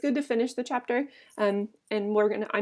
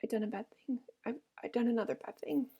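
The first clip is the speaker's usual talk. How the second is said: hushed, softer, fearful; frightened whisper; 7 dB quieter than usual. A narrator's reading. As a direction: murmur it in shock. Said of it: "he whispered in a fright".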